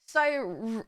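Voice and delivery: usual speaking voice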